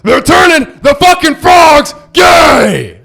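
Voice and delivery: slow, high, loud ranting bellow; mock eighties wrestler bellow; Alex Jones rant. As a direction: shout it like a conspiracy radio host. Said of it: "It's the same voice".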